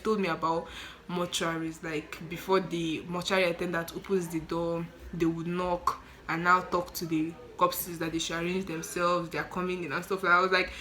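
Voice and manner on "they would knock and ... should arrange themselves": ordinary talk, nothing marked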